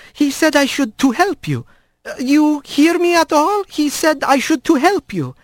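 gentle halting. Acted. Careful halting foreign speech.

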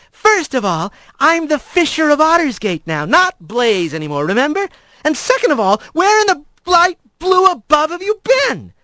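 high female voice, mock-indignant; scolding Blaze. Blaze scolds Rat for vanishing, mock-indignant. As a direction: scold him like an old friend.

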